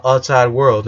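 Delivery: plain reading voice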